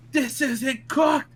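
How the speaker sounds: high, slow, hammed-up yell; imitating a yelling cooking teacher; mock cooking-teacher yell. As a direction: bellow it like a furious chef teacher. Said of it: "She would yell".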